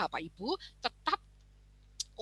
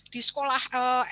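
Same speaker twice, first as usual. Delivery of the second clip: ordinary talk, nothing marked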